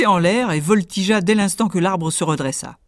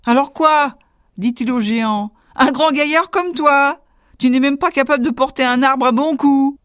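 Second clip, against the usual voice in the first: higher, louder scolding scoff; mocking tailor; 8 semitones higher, 5 dB louder than usual. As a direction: taunt the big man. Tailor mocks giant.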